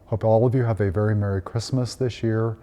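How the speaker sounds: ordinary talk, nothing marked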